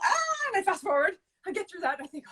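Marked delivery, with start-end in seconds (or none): 0.0-0.5 high panicked yelp; startled shriek. Retold or imitated. Mom panicking in front of her kids, high yelp. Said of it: "I'm like".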